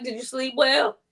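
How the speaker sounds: high, syrupy mock-polite question; teasing fake-friendly voice; sarcastic sweetness. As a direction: ask sweetly, twisting the knife. Needling neighbor with sweetness.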